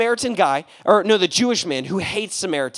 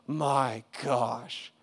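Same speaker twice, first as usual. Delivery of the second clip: softer, very slow realization; man quietly realizing his prejudice was wrong; slow dawning awe; 7 semitones lower, 6 dB quieter, much slower than usual. Retold or imitated. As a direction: let it sink in. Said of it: "he realizes".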